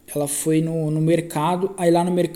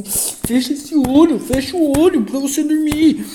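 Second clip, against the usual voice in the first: higher and louder, slow and insistent; irritated command; 8 semitones higher, 5 dB louder, slower than usual. Retold or imitated. Husband, exasperated, ordering his wife in the hospital bed to close her eyes, high and loud. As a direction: snap it out, fed up with her not sleeping. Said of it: "ficava puto".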